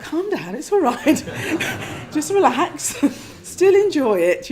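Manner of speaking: high, laughing, telling herself to relax; laughing self-calming. Retold or imitated. Meditator laughingly soothing her own excitement, high and amused. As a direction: giggle through it as you calm yourself. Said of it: "And I was like".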